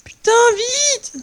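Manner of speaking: high, slow panicked cry. Real, not acted. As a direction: wail for them to hurry. Panic as mobs come.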